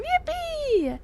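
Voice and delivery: high squeaky ironic yippee; sarcastic little celebration; mock cheer. Mock cheer about capitalism.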